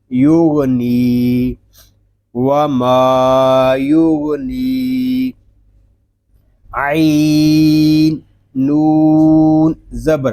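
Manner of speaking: slow, drawn-out letter-by-letter recitation; Quran spelling drill. Shown as a demonstration. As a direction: spell out each letter, stretching it slowly. (धीमे, खींचकर हिज्जे करते हुए)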